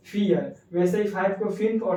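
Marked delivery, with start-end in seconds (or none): 0.1-0.5 फियर said as German "vier", two syllables; short German फियर; German pronunciation. Shown as a demonstration. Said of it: "क्या बोलते हैं?".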